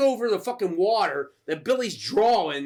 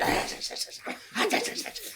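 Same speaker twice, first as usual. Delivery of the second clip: high, voiceless rattling cackle; imitated creature chitter in stuttering bursts; gremlin chattering; 13 semitones higher, 7 dB quieter than usual. Other gremlin reacting to Gizmo, high chattering cackle. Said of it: "the other one's like".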